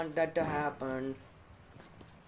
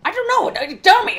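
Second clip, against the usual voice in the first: much higher and louder, exasperated squeal; frustrated squeal; 17 dB louder than usual. Real, not acted. Frustrated gamer stuck without a keycard, high exasperated squeal.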